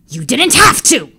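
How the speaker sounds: high, loud yell; raging emperor; furious. Acted. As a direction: shout it down at him. Emperor furious before strike.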